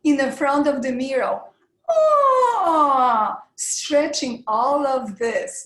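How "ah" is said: high, loud falling ah; face-stretch warm-up ah. Shown as a demonstration. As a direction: open wide and let it fall. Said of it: "making funny faces".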